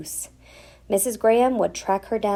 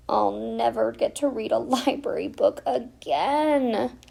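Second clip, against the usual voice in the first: whining; 4 semitones higher than usual. Acted. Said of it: "whined Stella".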